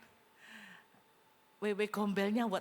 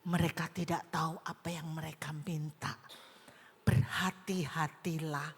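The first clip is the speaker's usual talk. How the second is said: whisper; 7 semitones lower than usual. Real, not acted. Preacher whispering a warning to the congregation. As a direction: breathe the warning like a secret.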